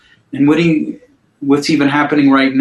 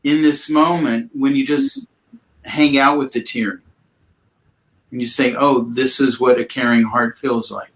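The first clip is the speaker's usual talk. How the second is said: guided mindfulness; 4 semitones lower than usual. Acted. Guides client through body awareness.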